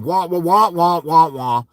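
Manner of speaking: droning wordless wah-wah; mock unintelligible teacher drone; Charlie Brown's teacher. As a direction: imitate a muffled adult droning on, sinking at the end. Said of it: "sound like Charlie Brown's teacher".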